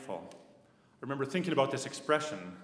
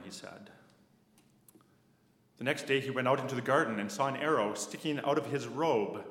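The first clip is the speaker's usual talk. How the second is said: ordinary talk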